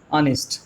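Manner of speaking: ऑनेस्ट said slowly with silent h, starting on आ; slow pronunciation model. Shown as a demonstration. Teacher modelling ऑनेस्ट slowly for the class. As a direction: model ऑनेस्ट as anest, no h sound. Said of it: "pronunciation इसका होता है".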